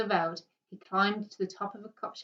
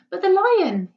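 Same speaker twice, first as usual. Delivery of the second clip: high, loud anguished cry; a boy crying out in alarm; desperate boy's outcry; 11 semitones higher, 11 dB louder, slower than usual. A narrator's reading. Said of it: "he cried".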